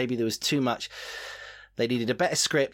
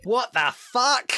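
high, slow, loud disbelief; stunned what the fuck; shocked outburst; 11 semitones higher, 6 dB louder, much slower than usual. Real, not acted. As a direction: blurt it out in shock. Shocked at vote result.

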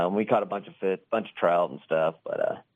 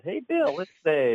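excited call; 8 semitones higher, slower than usual. Retold or imitated. Calling out to Bill in story.

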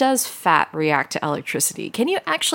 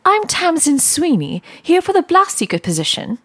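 chipper applicant voice; 10 semitones higher than usual. Acted. Cheery applicant introduces herself.

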